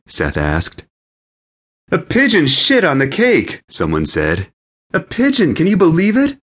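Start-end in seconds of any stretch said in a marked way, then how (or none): 1.9-3.6 high, alarmed exclamation; outraged cry. A narrator's reading. Wedding guest reporting the pigeon disaster, high outraged cry. 4.9-6.4 very high, incredulous shout. A narrator's reading. Stranger at the wedding in disbelief, shouting high. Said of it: "another person I didn't recognize shouted".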